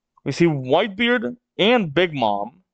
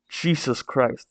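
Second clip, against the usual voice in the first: plain reading voice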